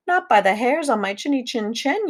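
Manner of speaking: high defiant piggy voice; lightly voiced high pig yell; defiant piglet yell. A narrator's reading. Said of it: "yelled Horace".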